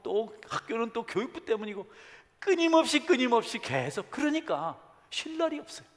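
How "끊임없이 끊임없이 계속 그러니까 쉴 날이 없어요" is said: much higher, louder lament; preacher's loud pained exclamation; impassioned outcry. Real, not acted. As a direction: cry out over the endless conflict. Preacher cries out, endlessly.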